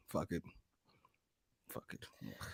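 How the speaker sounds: very low, hushed surrender; resigned. Real, not acted. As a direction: let it drop away under your breath. Giving up after the rant.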